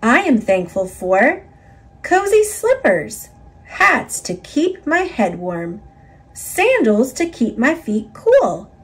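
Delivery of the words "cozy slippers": plain reading voice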